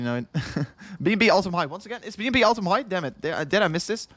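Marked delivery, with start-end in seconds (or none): none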